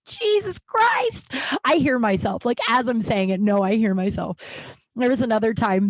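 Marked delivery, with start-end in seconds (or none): 0.1-1.3 incredulous. Real, not acted. Laughing at her own story.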